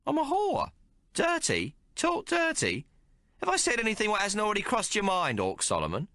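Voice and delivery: a streetwise barmaid teasing the hero; Maggie's voice. Acted. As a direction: play her worldly and mocking. Maggie defends her talk.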